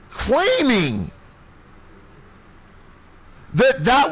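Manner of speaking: hammering rage, pitched far up; furious shouting tirade. Retold or imitated. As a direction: lay into him with every word. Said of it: "I am so pissed".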